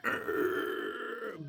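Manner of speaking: imitated grinding groan, high; straining noise. Young man describing forcing a ship's helm round, straining groan. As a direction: mimic a stuck helm straining.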